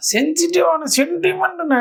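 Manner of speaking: high mock-tearful quote; imitated crying. Telling viewers how the leader cried, mock weepy. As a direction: stretch it into a fake weepy wail. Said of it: "அழுதாரு".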